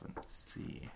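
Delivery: whispering. Real, not acted. Peeling tape off to look.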